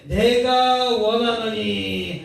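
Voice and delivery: slow, solemn voice of Jesus; drawn-out words of Jesus; Jesus speaking. Acted. Preacher voicing Jesus to the leper, slow and solemn.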